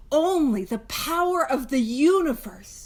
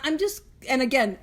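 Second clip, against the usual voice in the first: ordinary delivery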